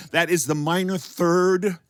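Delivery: normal talking voice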